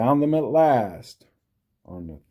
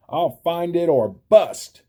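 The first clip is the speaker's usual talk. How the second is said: higher, louder determined cry; 8 semitones higher, 5 dB louder than usual. A narrator's reading. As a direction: vow it loudly, high and firm. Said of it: "cried Horton".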